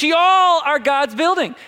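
loud, slow, high Southern drawl; Southern lady. Acted. Pastor repeats verse to the congregation, Southern lady accent. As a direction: lean long and loud into a Southern y'all. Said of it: "like a nice Southern lady saying y'all".

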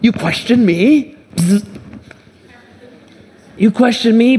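mock offended God. Preacher mocks an offended God scolding a doubter, hammed-up indignation. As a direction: play a huffy, offended boss, half joking. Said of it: "He doesn't look at you and say".

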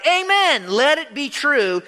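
high, loud cry of worship; soaring exclamations, easing at the end. Acted. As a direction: cry it out in ringing praise. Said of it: "They cried".

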